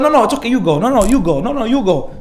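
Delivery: exaggerated back-and-forth deference; over-polite insisting. Driver waving the other car on, mock over-polite.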